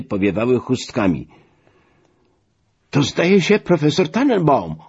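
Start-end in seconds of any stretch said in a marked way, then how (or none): 2.9-4.8 high-pitched, raised-voice exclamation; exclaiming. A narrator's reading. Delegate spotting the professor on the shore from the motorboat, exclaiming. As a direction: call it out, pitch shooting up. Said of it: "wykrzyknął Dąbski".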